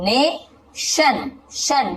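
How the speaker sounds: ने and शन stretched slowly and set apart; syllable by syllable. Shown as a demonstration. Teacher splitting nation for learners, ने and शन slowly separated. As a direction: sound out "ne", pause, "shan", then repeat शन. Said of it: "या फिर इसे नेशन".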